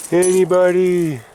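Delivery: playful high call into hole. Real, not acted. Calling into a hole.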